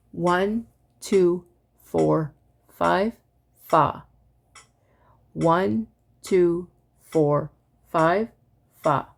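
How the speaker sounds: evenly spaced counting ending on fa; reverse recitation. Shown as a demonstration. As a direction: count it back in strict time, one word per click. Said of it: "the reverse would sound like this".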